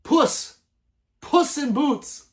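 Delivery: high, loud shout of the name; loud, stretched cry of sudden recall; excited shout. Real, not acted. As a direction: yell it out as it finally comes to you. Remembering Shrek cat, shouting name.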